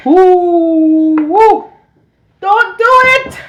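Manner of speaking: high loud slow wail; mouth burning from spicy food. Real, not acted. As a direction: howl a warning through the burn. Burning from hot sauce.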